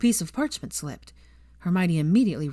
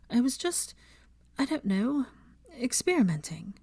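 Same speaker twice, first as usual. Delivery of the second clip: anxious; 7 semitones higher than usual. A narrator's reading. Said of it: "sounding anxious".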